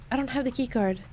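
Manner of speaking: ordinary talk, nothing marked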